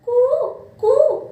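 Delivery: cuckoo call, high and loud; high loud coo. Shown as a demonstration. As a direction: sing out a bright cuckoo coo. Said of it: "ऐसे होता है तो cuckoo का sound". (ऊँची, ज़ोरदार कोयल जैसी कू)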